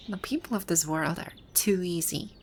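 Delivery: ordinary delivery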